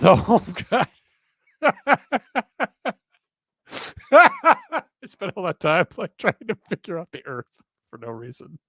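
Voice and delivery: words spilling through real laughter; laughing at herself. Real, not acted. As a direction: laugh at your own silly mistake. Laughing at own mistake.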